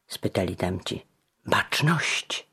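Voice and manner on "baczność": urgent hushed warning; whispered. A narrator's reading. Giano warns his friends in a whisper. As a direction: breathe it out, someone's coming. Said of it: "szepnął".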